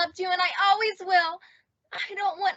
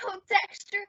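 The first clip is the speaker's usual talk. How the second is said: ordinary talk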